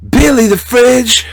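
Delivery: high, loud, slow name announcement; announcer call-out. Pretend.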